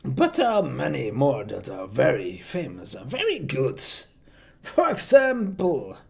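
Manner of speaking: theatrical villain voice with swooping pitch; stretched words, lurching up and down in pitch; Joker. Shown as a demonstration. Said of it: "that accent from Mister J himself".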